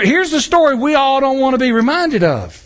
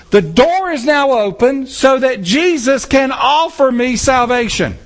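normal talking voice

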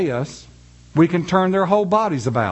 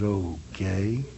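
low, hushed, hesitant okay; a cautious low okay, sensing a trap; quiet wary okay; 10 semitones lower, 9 dB quieter than usual. Retold or imitated. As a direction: say it warily under your breath. Wary reply in story.

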